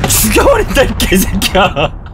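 a high joking yell; mock shout. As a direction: yell a joke threat at a friend. Mock threat at a viewer.

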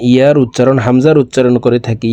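ordinary talk

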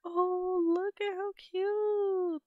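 high, slow, cooing; cutesy gushing. Real, not acted. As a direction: coo over the cute pet. Cooing at a cute pet.

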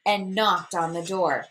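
plain reading voice

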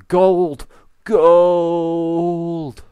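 high, loud shriek of joy; excited shout. Real, not acted. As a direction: cry out in delight. Finds gold.

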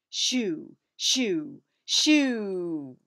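loud, building shoos; shouting. Acted. Angelina shouting to scare Big Cat away. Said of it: "she shouted".

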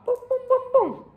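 very high popping "pum" noises; imitated explosions. A narrator's reading. Narrator imitating the giant's petocchi, high booms. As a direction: row of high-pitched mock bangs.

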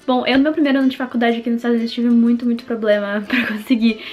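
upbeat sponsor read. Acted. Sponsor read before the vlog.

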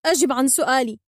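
loud, sharp order; angry, forceful demand; demanding shout. A narrator's reading. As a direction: shout for an answer. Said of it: "فصاحت عليه".

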